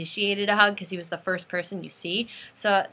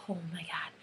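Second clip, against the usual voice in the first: soft, lower disbelief; stunned murmur; 4 semitones lower, 14 dB quieter than usual. Retold or imitated. Fan who just met the band, stunned and hushed. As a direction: say it under your breath, overwhelmed.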